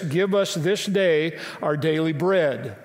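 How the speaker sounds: slow, deliberate prayer line; slow recitation. Shown as a demonstration. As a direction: recite it slowly, weighing each word. Quoting the Lord's Prayer slowly.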